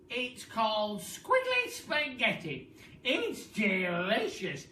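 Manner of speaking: put-on loud honeyed old hag voice; sly Missus Twit. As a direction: coax him with fake sweetness. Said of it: "Missus Twit coaxes husband".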